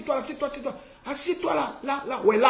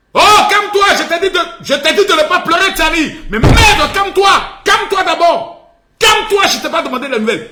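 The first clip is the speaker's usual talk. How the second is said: high, loud yelling, fast in the middle; exasperated shout; 8 semitones higher, 4 dB louder than usual. Acted. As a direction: lose patience and yell. Yelling at crying Tiani. (crie, exaspéré, calme-toi)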